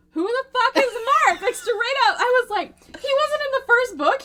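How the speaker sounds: high, loud, slowed squeal of disbelief; indignant disbelief. Retold or imitated. Friend retells reading a book, shrieking in disbelief. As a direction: screech it like the book betrayed you. Reader's outraged reaction retold.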